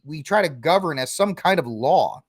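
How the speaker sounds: normal talking voice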